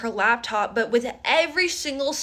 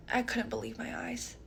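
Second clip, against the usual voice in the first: ordinary talk, nothing marked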